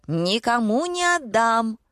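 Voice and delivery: high, stretched possessive squeal; narrated high-pitched shriek. A narrator's reading. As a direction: screech it slowly and high. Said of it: "заверещала покупательница".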